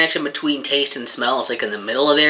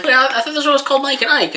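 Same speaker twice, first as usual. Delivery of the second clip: confused; 12 semitones higher, 4 dB louder, faster than usual. Retold or imitated. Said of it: "I was like".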